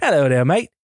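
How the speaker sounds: jokey Australian greeting; playful Australian accent. Acted. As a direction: put on a mock Aussie accent. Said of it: "do an Australian accent".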